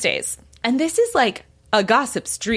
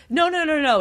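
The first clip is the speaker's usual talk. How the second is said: quick, much higher run of no's; hasty denial; 7 semitones higher than usual. Retold or imitated. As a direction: brush it off fast, mock flustered. Uma hastily denies owning dog.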